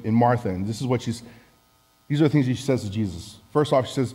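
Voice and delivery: usual speaking voice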